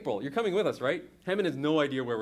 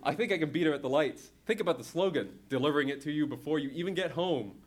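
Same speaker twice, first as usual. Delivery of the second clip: plain reading voice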